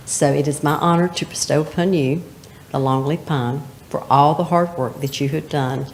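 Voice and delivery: ordinary talk, nothing marked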